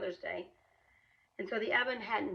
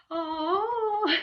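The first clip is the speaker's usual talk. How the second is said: high, loud, pleased little "Oh"; a proud surprised oh, pitched well up; 11 semitones higher, 10 dB louder than usual. Retold or imitated. Husband's reaction retold, he goes.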